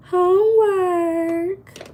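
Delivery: high, drawn-out mock whine; mock answer. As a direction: stretch it out as a joke. Joking answer about weekend plans.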